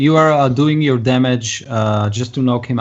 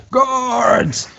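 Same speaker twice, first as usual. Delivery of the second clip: high, drawn-out cry for guards; screamed call; 12 semitones higher than usual. Acted. Game master voices blinded man clutching his axe, screaming. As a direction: scream it out, pitched way up. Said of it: "and he screams".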